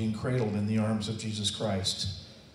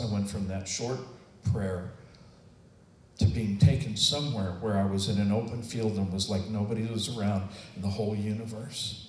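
ordinary delivery

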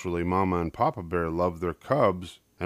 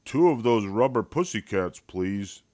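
embarrassed Papa; 2 semitones higher than usual. A narrator's reading. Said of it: "said embarrassed Papa".

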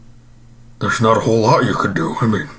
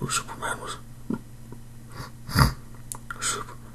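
fading out; 16 dB quieter than usual. Acted. Man slipping into a sugar coma, voice fading away. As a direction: voice dying away to nothing.

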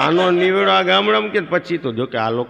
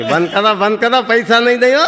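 louder and higher, yelling "બંધ કરાવો" to stop the band; teasing shout; 7 semitones higher, 5 dB louder than usual. Retold or imitated. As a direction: tease the band by hollering, climbing up. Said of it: "Dhiru હળી કાઢતો કે". (બેન્ડવાળાને ચીડવતી મોટેથી બૂમ)

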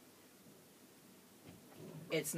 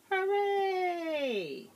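higher, bright happy cheer; 10 semitones higher than usual. A narrator's reading. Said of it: "And Ronald said".

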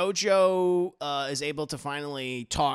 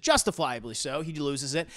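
ordinary delivery

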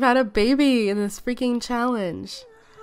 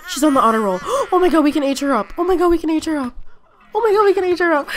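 high, gasping, gushing excitement; freaking out with excitement; 6 semitones higher, 5 dB louder, faster than usual. Real, not acted. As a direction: gasp and gush, higher and louder. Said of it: "I'm, like, freaking out".

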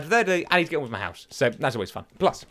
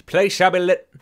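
1 mock proclamation; 2 semitones higher, 5 dB louder than usual. Grand proclamation lighting the cave.

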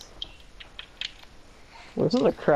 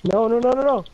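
loud high frantic nos; desperate shouting; 9 semitones higher, 5 dB louder than usual. Real, not acted. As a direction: yell in despair as you lose. Losing the fight.

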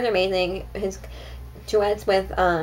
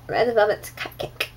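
accidental British accent. Real, not acted. Vlogger showing viewers a candle, slipping into a British accent. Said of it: "a British accent saying that".

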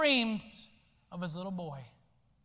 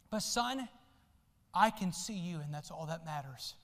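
tender dad; 5 semitones lower, 7 dB quieter than usual. Acted. Dad reassuring unseen son.

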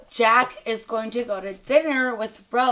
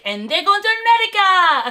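high, loud mock-triumphant shout; exultant hollering; 10 semitones higher, 9 dB louder than usual. Telling friend they're off on Titanic, exultant hollering. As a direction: holler it with glee, voice shooting up. Excited climax of retelling.

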